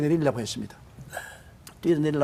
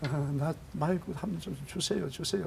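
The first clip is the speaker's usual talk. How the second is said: a hint of a frail old woman, much softer; trembling old woman; 2 semitones higher, 8 dB quieter than usual. Old grandmother softly begging the pastor for the mic. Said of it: "벌벌벌벌 떨면서".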